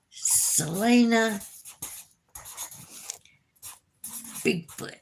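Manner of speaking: dollar sign Selena Bigfoot drawn out word by word; slow, careful spelling. Shown as a demonstration. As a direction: spell it slowly for viewers. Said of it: "so it would be".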